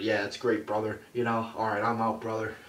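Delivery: a lighter hint of the Hogan promo voice; signing off like Hulk, brother; Hulk Hogan impression. Vlogger wrapping the Hogan bit, light Hogan voice. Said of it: "That's probably what he would have done".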